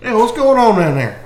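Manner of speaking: high-pitched hail from afar; calling out. Acted. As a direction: shout a question over to them, lightly. Character calls out to distant slavers.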